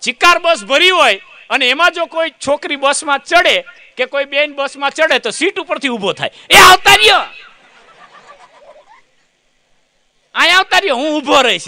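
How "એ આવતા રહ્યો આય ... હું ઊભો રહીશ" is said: put-on high, loud eager young man; young man eagerly offering his bus seat, loud and high; eager shout. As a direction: call out keenly to offer the seat.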